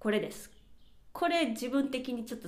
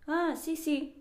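し said flat and quick, agreeing; ああ、し、し as a casual yes; affirming sí; 6 semitones higher, slower than usual. Shown as a demonstration. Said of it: "じゃなくて".

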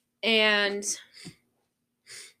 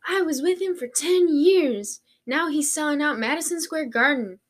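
bragging fan voice; 6 semitones higher, 4 dB louder than usual. Retold or imitated. Mocking a bragging superfan.

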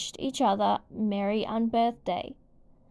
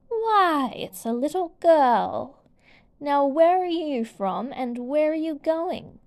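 higher, louder, haughty royal voice; imperious queen; 5 semitones higher, 5 dB louder than usual. A narrator's reading. Queen of Hearts questions Alice, haughty and loud. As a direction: look down on the little girl.

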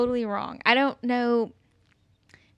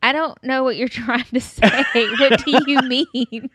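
laughing; 3 semitones higher, 8 dB louder than usual. Retold or imitated. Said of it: "I was like".

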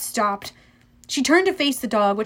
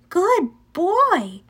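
higher praise, swooping on each word; praising a dog; 7 semitones higher than usual. A narrator's reading. Annabeth praises Cerberus for dropping the ball, approvingly. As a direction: reward the dog with your voice.

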